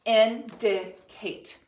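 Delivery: "Indicate" slowly in three separate, even beats; syllable by syllable. Shown as a demonstration. Teacher counting syllables with the class, syllable by syllable. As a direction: pound out "Indicate" with a gap after each syllable. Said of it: "broken into three syllables".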